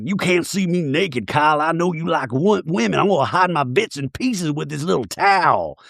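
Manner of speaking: mock Southern lady, slightly lilting; Sutton. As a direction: play it prim with a Southern accent. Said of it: "And Sutton's like".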